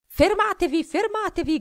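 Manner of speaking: grido acuto e forte; Thorin shouting. A narrator's reading. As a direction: urla per fermare i nani. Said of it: "gridò Thorin". (Thorin grida, voce molto più alta e forte)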